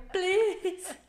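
high, comic pleading; hammed-up helpless begging; mock helpless plea. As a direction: act out a lost traveller's high-pitched plea. Repeats the airport plea, laughing after.

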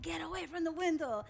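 high, loud acted warning yell; retold yell. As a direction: call it out sharply, imitating the old yelling. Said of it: "we would literally yell at him".

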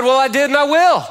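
high, insistent comeback; acted stubborn promise; mock defiant. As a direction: dig in, hammed-up. Husband insists he will do it.

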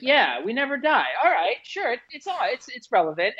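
high, airy mock agreement; gullible follower voice. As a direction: play a credulous believer cheerfully going along. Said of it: "And everyone's like".

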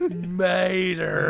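long, drawn-out southern "Mater"; hammed-up southern accent on "Mater"; southern drawl. Shown as a demonstration. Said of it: "that southern drawl".

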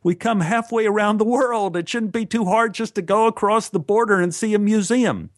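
insisting the trip is easy, voice pushed up high; protesting. Retold or imitated. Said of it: "I protested".

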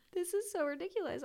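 high, quick words through tears; embarrassed at crying, hurry it out; real tearful voice. Real, not acted. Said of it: "I'm crying over this".

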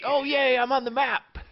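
high, put-on squeal of delight; mock excited. Host plays the cave listener finally noticed, mock excited.

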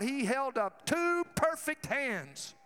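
usual speaking voice

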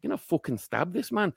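Irishman seething under his breath; muttered thought. Retold or imitated. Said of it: "Irish goes to himself. He's thinking".

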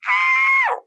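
high burst of a scream; a sudden high squeal of delight; excited scream. Retold or imitated. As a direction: scream with joy at the news. Screaming at job offer.

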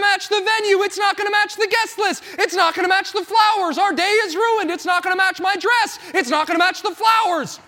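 high, shouted mock panic over the wedding. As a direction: wail it like a frantic groom, half in jest. Panicked groom mocking wedding worries.

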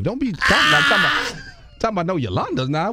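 hammed-up alarm about the wife hearing; mock panic. Protesting wife might hear, comic.

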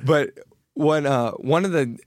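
high laughing through the words. Real, not acted. A parent laughing at a toddler's reply, high and laughing.

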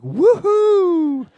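put-on high, excited little-kid woohoo; squealed kid's woohoo; child's woohoo. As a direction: cheer like a thrilled small boy. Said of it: "He'd be like".

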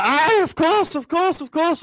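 can't say yes fast enough; overjoyed yes. Retold or imitated. Young [name] accepting the gig on the phone, high gushing excitement. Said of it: "I was like".

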